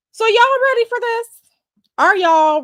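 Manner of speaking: high, loud hype to the viewers; high-pitched excited "y'all ready"; hyped-up call. Real, not acted. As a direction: pump up the audience. Hyping up her viewers.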